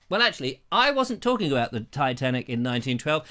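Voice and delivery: ordinary delivery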